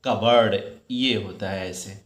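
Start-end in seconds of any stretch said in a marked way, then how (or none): 0.0-0.6 कबर्ड, the p and oa swallowed; correct pronunciation. Shown as a demonstration. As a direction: say कबर्ड as two quick syllables, ka-bard. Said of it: "pronunciation करोगे तो क्या करोगे". (कबर्ड को कबर्ड ही बोलें, कप बोर्ड नहीं)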